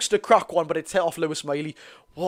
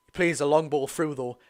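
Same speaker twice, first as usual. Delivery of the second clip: ordinary talk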